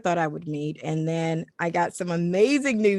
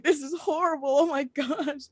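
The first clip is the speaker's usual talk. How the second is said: sniveling; 8 semitones higher than usual. Retold or imitated. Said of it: "I'm sniveling".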